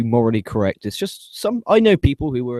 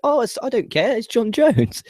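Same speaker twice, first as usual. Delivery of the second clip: higher, breezy not-caring voice; mock dismissive; 9 semitones higher than usual. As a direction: brush it off like a careless fan. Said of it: "everyone's like".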